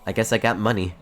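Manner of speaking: normal talking voice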